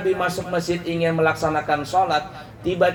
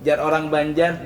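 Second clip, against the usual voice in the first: ordinary talk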